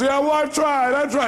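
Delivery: hammed-up impression of a caller giving up; imitating caller. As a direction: play the caller saying she tried. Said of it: "She goes".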